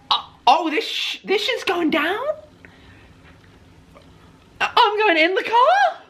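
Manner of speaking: high, stretched-out squeak of shock; squeaky shocked disbelief. Retold or imitated. Guest realising he is really riding along, in a high shocked squeak. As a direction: let the realisation squeeze your voice up.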